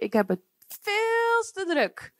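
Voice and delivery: lightly voiced busy person brushing off the church; mock busy excuse. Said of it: "Ik hoor dan wel eens".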